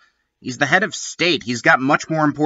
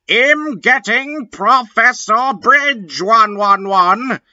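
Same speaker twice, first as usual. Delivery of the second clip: angry shout; 9 semitones higher than usual. A narrator's reading. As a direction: yell it, fed up. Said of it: "Cornelio Fuck shooted angrily".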